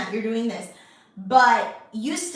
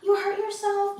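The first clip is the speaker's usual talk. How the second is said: put-on soothing a hurt child; 10 semitones higher than usual. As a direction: coo over a scraped knee. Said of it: "I have to be able to go".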